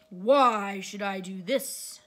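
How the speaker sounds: loud, slow demand; a great beast's drawn-out challenge. A narrator's reading. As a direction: boom the question out slowly. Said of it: "demanded the beast".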